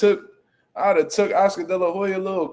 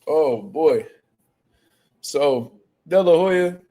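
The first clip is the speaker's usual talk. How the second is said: ordinary delivery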